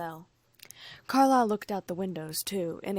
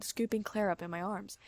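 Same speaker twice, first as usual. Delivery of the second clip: ordinary delivery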